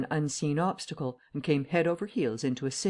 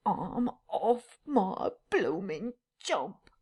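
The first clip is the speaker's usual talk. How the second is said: distressed tramp; 6 semitones higher, much slower than usual. Acted. Tramp despairs at unseen voice.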